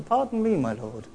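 mock-polite, sinking to a soft murmur; sarcastic courtesy; fake politeness. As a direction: put on a show of courtesy. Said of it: "simulating politeness".

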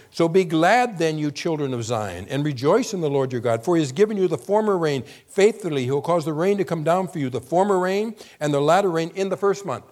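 loud, high proclaiming of scripture; proclaimed scripture. Acted. Preacher reading Joel to the congregation, loud and proclaiming. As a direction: proclaim it to the hall.

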